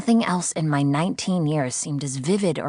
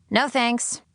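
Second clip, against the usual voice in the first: much higher, flippant no; sarcastic refusal; 9 semitones higher than usual. Acted. Sydney refusing her captor, higher flippant sarcasm. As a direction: brush it off.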